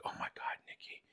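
hushed, breathless whisper; shocked whisper. Retold or imitated. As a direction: whisper it so he can't hear. Friend reacts after husband's call.

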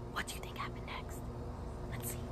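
hushed, secretive whisper. Shown as a demonstration. Teacher to class, building suspense in a whisper. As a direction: lean in and whisper the question.

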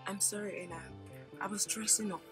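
very low, hushed apology; subdued, barely voiced sorry; meek apology. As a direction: apologise small and quiet under a telling-off. Apologising to an angry host.

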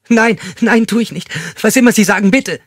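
high, loud frantic begging; panicked pleading. Acted. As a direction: rush to obey him in terror. Terrified captive pleading. (panisch hoch und laut bettelnd)

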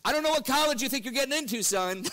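a hint of a stern father; dad's stern warning. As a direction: play the exasperated dad warning his son. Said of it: "just as my dad said".

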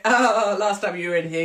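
loud mock announcement; teasing call-out. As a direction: call it out for the whole queue. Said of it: "he went".

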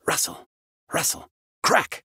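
breathy rustling noise; whispered rustle and crack. A narrator's reading. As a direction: hush out the rustle, snap the crack. Sound effect in story.

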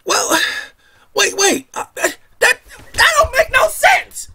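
high, loud mock objector; mock skeptic. Mimicking a doubter who cuts in, high and loud. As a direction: squeal out a scoffing skeptic.

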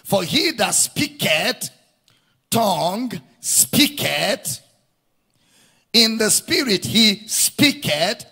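slow verse reading, punching speaketh; stress on speaketh. Shown as a demonstration. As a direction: draw out and drive home each speaketh. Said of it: "Emphatic mention".

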